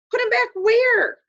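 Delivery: high, loud outraged question; can't believe the suggestion; indignant. Acted. Patrick protesting to Omri in loud disbelief.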